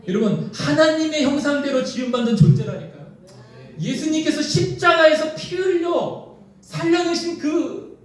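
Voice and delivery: high, slow, insistent proclamation; impassioned preaching. Real, not acted. Preacher insisting on the worth of wives, impassioned preaching. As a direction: drive the point home to the congregation.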